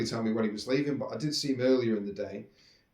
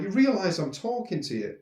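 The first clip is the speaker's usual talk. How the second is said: pitched way up, disbelieving; retold indignant disbelief, higher; incredulous; 6 semitones higher than usual. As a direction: make him notice he's being rude.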